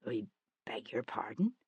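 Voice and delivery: whispered polite puzzlement; breathy whisper. Acted. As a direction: murmur it, bewildered. Confused timid reply.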